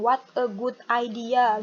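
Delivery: "What a good idea" word by word, Indonesian accent; unlinked words in a heavy Indonesian accent; too Indonesian. Shown as a demonstration. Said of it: "too Indonesian, terlalu Indonesia banget". (logat Indonesia banget, kata demi kata)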